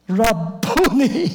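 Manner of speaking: high, slow, drawn-out Rabboni; joyful cry of recognition. Acted. Mary recognizing Jesus, crying out with joy. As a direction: Rabboni sung out high and long with sudden joy. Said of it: "she turned around and she said".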